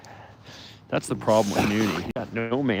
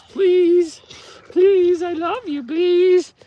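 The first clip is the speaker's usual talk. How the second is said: much higher, squeaky begging voice; pleading puppy voice; 6 dB louder, much slower than usual. Pretend. Owner voicing the puppy begging the old wolf. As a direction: beg like a frantic little puppy. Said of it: "He says".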